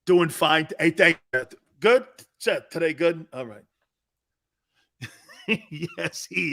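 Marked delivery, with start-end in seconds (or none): none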